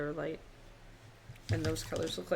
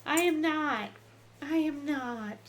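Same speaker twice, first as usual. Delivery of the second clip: higher, put-on pouty dog voice; voicing the dog; 9 semitones higher, 5 dB louder than usual. Owner voicing her fluffy dog complaining about the heat, higher pout. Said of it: "She says".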